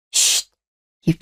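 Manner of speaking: 입 다물라는 짧은 쉿; 조용히 하라고 급히 막듯; hushing. Acted. Hushing brother at work. (회사 얘기 막으며 쉿)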